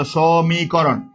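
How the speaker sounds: সমীকরণ stretched very slowly syllable by syllable; drawn-out word. Shown as a demonstration. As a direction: draw out each syllable of সমীকরণ for the class. Teacher stretches out the answer. (সমীকরণ টেনে টেনে ধীরে বলা)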